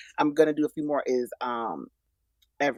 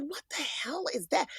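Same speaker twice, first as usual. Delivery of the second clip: whisper; 8 semitones higher, 7 dB quieter than usual. Retold or imitated. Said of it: "He was like".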